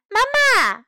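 high, loud call; crying out. Acted. May falls and calls her mother, loud high cry. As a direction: call for mommy after the fall. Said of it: "May叫了起来".

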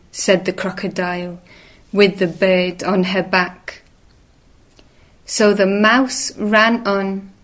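ordinary delivery

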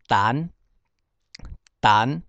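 胆 as "tân" with rising tone; Hokkien reading. Shown as a demonstration. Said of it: "就念成胆".

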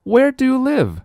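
put-on very high child voice; high-pitched young heroine; eager little girl. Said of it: "says Nellie".

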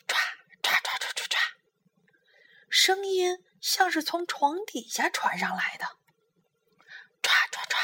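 whispered scratch-scratch noises; breathy imitation of claws scratching under the bed; scratching sound. A narrator's reading. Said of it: "这一回的响声是抓东西的声音".

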